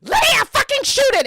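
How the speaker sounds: shrieking high-pitched scream across a battlefield; panicked high woman shrieking an order; high-pitched woman yelling. Acted. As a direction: scream it at the top of your voice. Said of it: "this high-pitched woman go".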